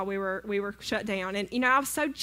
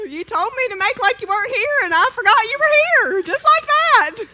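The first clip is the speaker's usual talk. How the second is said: much higher and louder, gleeful and cheeky; triumphant tease; 11 semitones higher, 11 dB louder than usual. Retold or imitated. To her husband who wanted breakfast, much higher and louder, gleeful tease. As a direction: gloat at him with a grin.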